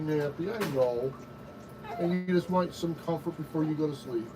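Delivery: lulling. Real, not acted. Lulling tired baby to sleep.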